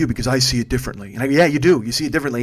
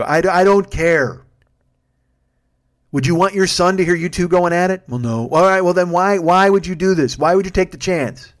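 exasperated yelling; 7 semitones higher, 6 dB louder than usual. Retold or imitated. Driver yelling at his passenger about a child hearing them. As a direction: let it all out at the guy.

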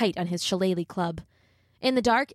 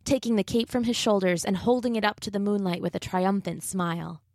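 ordinary delivery

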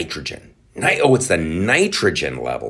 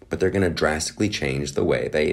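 normal talking voice

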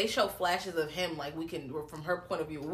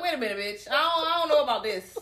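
laughing; 7 semitones higher than usual. Retold or imitated. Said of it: "I'm like".

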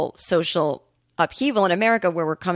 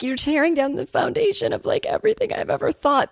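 high-pitched mock upset woman; imitated tearful distress; 10 semitones higher than usual. Woman protesting as her beliefs are torn down, imitated tearful distress. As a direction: retell her distress in a much higher voice. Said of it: "and you see her crying".

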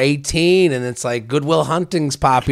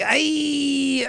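high, softer drawn-out "ay"; 11 semitones higher, 5 dB quieter than usual. Acted. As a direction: whine a long high "ay". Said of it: "gotten laid from, like".

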